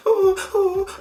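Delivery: high, loud fake sobbing; mock crying. Vlogger imitating Regina George's fake crying. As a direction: whimper theatrically like a spoilt girl. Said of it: "Regina George is crying".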